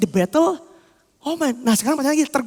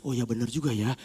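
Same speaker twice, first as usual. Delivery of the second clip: very low, soft realization; quietly concede, half to oneself; musing agreement; 14 semitones lower, 10 dB quieter than usual. Acted. Inner self won over by conscience, quietly conceding.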